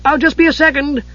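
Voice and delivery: very high, busy little voice; high-pitched, preoccupied character; piping character voice. A narrator's reading. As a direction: call back high while rummaging. Tass's piping voice while searching pouches.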